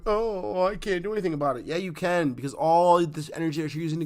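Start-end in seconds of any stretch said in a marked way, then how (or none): 0.1-1.7 high, fast imitation of a complainer; mock helpless whine; mock complaining. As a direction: mimic someone moaning helplessly, pitched up and rushed. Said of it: "complaining and going".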